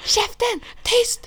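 high, sharp shrieked hush; shrieking shut up. Retold or imitated. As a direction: shriek for silence. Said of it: "Hon bara".

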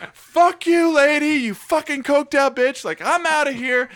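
high-pitched mock angry yell; retold screaming. Quitting on the coked-up manager, mock screaming. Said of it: "I'm screaming this at the top of my lungs".

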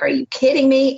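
mock his shock at the refusal; incredulous. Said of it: "He's like".

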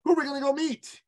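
put-on high excited little boy voice. Little son asking his mommy, high and excited. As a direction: chirp it like an eager small child. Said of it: "my little son said".

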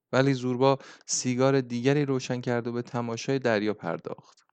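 ordinary delivery

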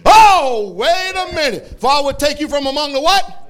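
high, loud "oh, wait a minute"; surprised interruption. Real, not acted. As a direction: catch the verse in mock astonishment. Preacher stops reading, surprised.

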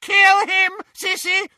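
very high, loud shriek. A narrator's reading. Bellatrix ordering her sister to kill, shrieking. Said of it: "shrieked Bellatrix".

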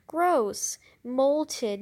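excited disgust. Acted. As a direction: shout it, thrilled and grossed out. Judy excited by spider skin.

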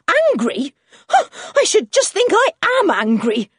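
loud, high-pitched retort; scandalised fury; indignant anger. A narrator's reading. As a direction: throw the word back with an outraged huff. Farmer's wife retorts in a rage.

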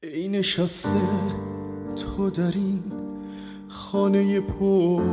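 normal talking voice

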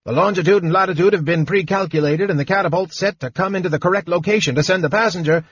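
high, very fast gnome voice; fast gnome chatter. A narrator's reading. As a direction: rattle off the numbers breathlessly. Gnome rattles off technicalities.

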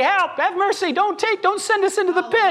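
high pleading demon voice; begging demons. Retold or imitated. Demons begging Jesus for mercy, pleading high. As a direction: cower and beg for mercy.

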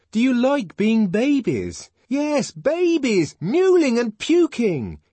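exasperated, high-pitched tirade; exasperated insult. A narrator's reading. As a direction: hurl insults at a crowd, stretching the words. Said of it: "exasperated by their bestial stupidity".

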